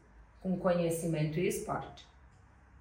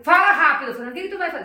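higher, louder, pushy client demanding speed; impatient client; 8 semitones higher, 11 dB louder, much faster than usual. Retold or imitated. Impatient client rushing the seller, lightly voiced. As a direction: snap it like a busy client. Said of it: "Se eu vou falar com o cara que".

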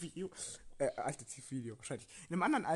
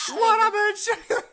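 shouted hook; 14 semitones higher, 14 dB louder than usual. Pretend. Shouted song line.

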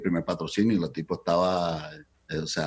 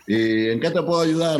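put-on older Cuban boss in a higher voice; Cuban accent; 8 semitones higher than usual. A teller voicing the Cuban manager, Cuban accent. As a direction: imitate the formal Cuban manager, pitched up. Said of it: "con el acento cubano".

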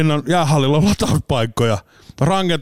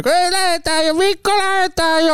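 put-on much higher, whiny child voice pleading to leave; whining kids. Kids nagging dad at the charger, high whine. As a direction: play a kid nagging dad from the back seat. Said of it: "lapset itkee siinä".

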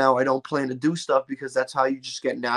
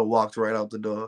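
ordinary delivery

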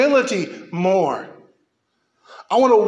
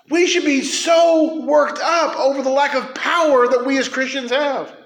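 shouting, higher and louder; loud sharp voice; 5 semitones higher than usual. Real, not acted. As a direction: yell it with urgency. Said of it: "Why are you yelling?".

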